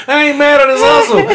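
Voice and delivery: high, loud street-guy voice; admiring hustler voice. Retold or imitated. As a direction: voice the grandson, impressed. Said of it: "He's thinking".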